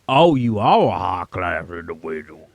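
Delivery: exaggerated Southern mockery; mocking Southern accent. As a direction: throw his words back in his accent. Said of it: "mockery of my accent".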